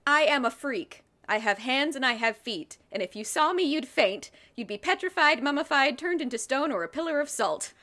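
a hammed-up squeaky spirit declaring itself; squeaky mongoose. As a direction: play the boastful ghost mongoose, squeaking. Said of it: "voice is squeaky then if he's a mongoose".

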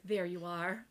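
low, soft tender relief; soft lover's relief. Retold or imitated. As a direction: say it low and gently. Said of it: "he's like".

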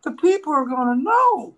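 high, loud mock whine; worried brother. Brother refusing to carry equipment openly, high loud mock protest. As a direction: imitate a reluctant brother protesting. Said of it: "and he goes".

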